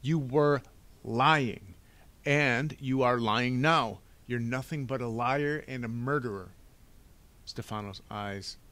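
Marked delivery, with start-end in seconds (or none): 0.0-6.5 loud, emphatic accusation; indignant cry. A narrator's reading. Boy accusing the villain of murder, indignant cry. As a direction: hammer out the charge at full voice. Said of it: "Klaus cried".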